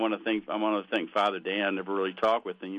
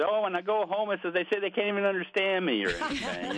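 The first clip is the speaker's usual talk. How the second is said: lightly voiced monsignor, pitched up, a mild hint of Irish; quoting the priest, a bit higher, barely in character; retold monsignor's reply; 9 semitones higher than usual. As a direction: priest retelling his trip home, just a hint of Irish.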